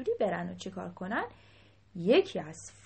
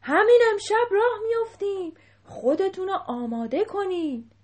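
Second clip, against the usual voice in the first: high, loud proclamation; loud command; 9 semitones higher, 11 dB louder than usual. A narrator's reading. King ordering the whole herd to get ready, loudly. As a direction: call the order out to everyone. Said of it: "با صدای بلند گفت".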